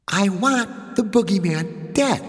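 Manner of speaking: put-on high, slow little-girl demand; a small boss insisting on her order; demanding child.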